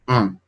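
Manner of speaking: An said softly through the nose; nasal an. Shown as a demonstration. Teacher to students, modelling nasal An. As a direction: let An ring in the nose. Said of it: "todas van a ser an".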